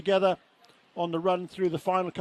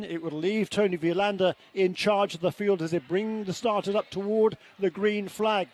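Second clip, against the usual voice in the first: usual speaking voice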